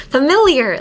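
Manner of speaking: "Familiar" with a loud, high, clear "lear"; pronunciation demo. Shown as a demonstration. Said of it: "the hard phrases would be".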